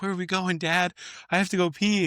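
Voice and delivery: put-on high-pitched whiny little kid. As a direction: play a small boy asking his dad. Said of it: "it's like a little kid".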